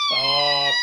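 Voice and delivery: high, loud squealed protest; squealing. Real, not acted. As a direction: squeal it out while laughing. Child squeals while tickled.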